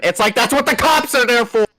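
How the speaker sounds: loud, exasperated outburst. Real, not acted. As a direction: vent the frustration loudly. Exasperated rant.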